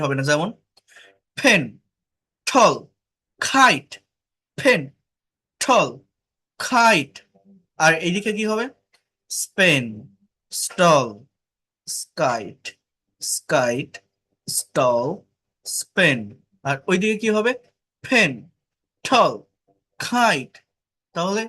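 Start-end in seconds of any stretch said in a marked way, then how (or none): 1.4-7.1 "ফেন", "ঠল", "খাইট" with strong puffs of air; aspirated stops. Shown as a demonstration. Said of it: "aspirated যদি হয়". 9.3-16.2 "স্পেন", "স্টল", "স্কাইট" with no puff after s; non-aspirated stops. Shown as a demonstration. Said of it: "non aspirated হবে". 18.0-20.5 "ফেন", "ঠল", "খাইট" with strong puffs of air; aspirated stops. Shown as a demonstration. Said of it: "aspirated যদি হয়".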